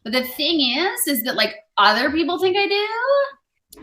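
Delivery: put-on high, bright, rising valley girl voice. Shown as a demonstration. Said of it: "you want me to be like an American Valley Girl".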